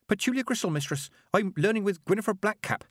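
put-on high, hesitant young girl; girl reporting to the witches; nervous girl. A narrator's reading. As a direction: stammer out the news shyly. Petulia answering nervously.

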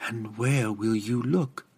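slow, measured question; calm lady ruler. A narrator's reading. Lady stepping before the young woman, calm challenge. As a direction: challenge gently, unhurried.

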